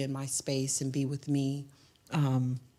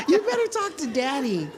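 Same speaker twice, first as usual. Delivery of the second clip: much higher and louder, laughing through it; laughing repeat; 11 semitones higher, 4 dB louder than usual. Retold or imitated. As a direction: say it again, cracking up.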